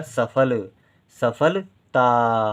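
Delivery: slow sounding out. Shown as a demonstration. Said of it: "teacher sums up the word".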